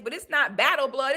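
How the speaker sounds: usual speaking voice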